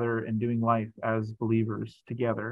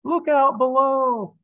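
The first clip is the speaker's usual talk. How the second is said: calling out; 13 semitones higher, 6 dB louder, slower than usual. Acted. Calling down from the sky.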